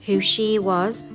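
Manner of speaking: plain reading voice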